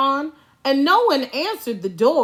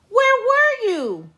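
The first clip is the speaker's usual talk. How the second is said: put-on eager kids; 11 semitones higher than usual. A narrator's reading. Kids eagerly ask returned teacher.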